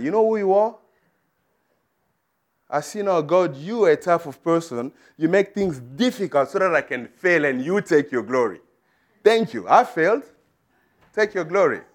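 retold outburst, pitched high and emphatic; indignant complaint. Fired man complains to God about failing, indignant.

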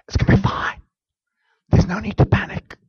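soft, near-whispered comfort; calm your own soul gently; hushed reassurance. Shown as a demonstration. A preacher soothing his own soul, softly. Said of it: "speaking to own soul".

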